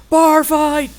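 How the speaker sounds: high, loud holler; rowdy full-volume shout; excited yell. Acted. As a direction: yell it out as if starting a saloon brawl. Calling out a saloon brawl.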